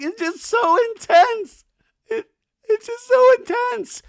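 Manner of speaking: very high, loud, slow whine; mock breakdown wail. Imitating Nia breaking down to Doty, mock wailing. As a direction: wail it in a squeaky, hammed-up meltdown. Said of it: "breaking down internally".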